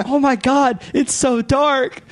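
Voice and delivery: high, slow cartoon dismay; high slow cartoon whine; Velma dismayed. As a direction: drag it out in mock horror. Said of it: "Velma's like".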